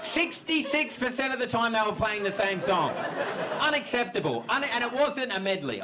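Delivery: high-pitched incredulous rant; comic indignation, high and emphatic; mock outrage. Mock outrage at the band's setlist.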